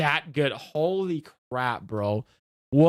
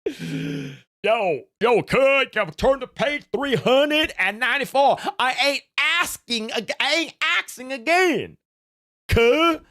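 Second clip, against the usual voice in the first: higher, street-tough hype; tough bully voice; 6 semitones higher than usual. Pretend. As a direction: bark orders at the class like a thug. Playing a tough school bully.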